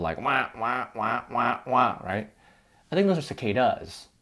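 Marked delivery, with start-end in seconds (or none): 0.2-2.0 repeated soft wah-wah buzz; cicada imitation. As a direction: mimic a cicada chirp.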